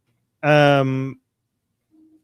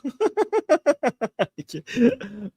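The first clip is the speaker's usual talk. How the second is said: high laugh; 10 semitones higher than usual. Real, not acted. Host laughing at the joke.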